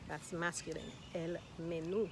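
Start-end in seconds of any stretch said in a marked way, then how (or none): 1.6-2.1 menú landing on a strong NÚ; menú, light first syllable, stressed last. Shown as a demonstration. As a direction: hit the final "oo" of menú. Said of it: "but we emphasize it".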